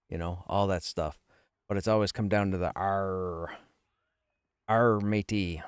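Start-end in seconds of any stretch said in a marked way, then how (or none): 2.8-5.6 long rolling pirate "Arr". Shown as a demonstration. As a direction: fall back into pirate talk. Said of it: "different tones for the pirate voice".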